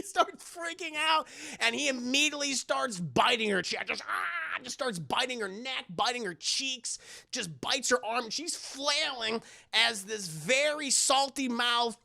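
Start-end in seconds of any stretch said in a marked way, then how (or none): none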